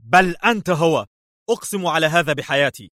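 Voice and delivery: high, heated insistence; sharp insistence. A narrator's reading. Levi insisting to the intruder, sharply. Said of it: "قال ليفي في حدة".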